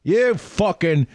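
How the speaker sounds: high, put-on foreign accent, not quite Irish; vague foreign accent. Angry islander scolding American tourists, in a high put-on foreign accent. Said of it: "that was not an Irish accent I did, but it sounded foreign".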